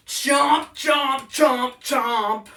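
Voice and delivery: loud, biting chomp shouts; kids' dance leader shouting the chomps; shouted chomps. Shown as a demonstration. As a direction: shout each chomp like snapping jaws. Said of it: "let's put that all together".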